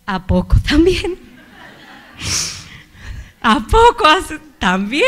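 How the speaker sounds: high, loud, incredulous whine through a laugh; laughing disbelief. Retold or imitated. Preacher reacting to God asking for a hug too, loud and incredulous. Disbelief at God's further request.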